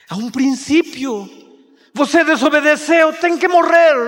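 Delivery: rising from firm to high, loud sentence; God pronounces death, growing stronger; stern divine voice building to a loud verdict. Acted. God voiced refusing sinner.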